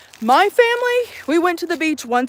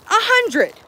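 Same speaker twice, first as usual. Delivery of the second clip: high-pitched disbelief at the price; incredulous repeat; 9 semitones higher than usual. Real, not acted. Vlogger balking at pricey pop-up poop tents, incredulous. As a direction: repeat it higher, scandalized by the cost.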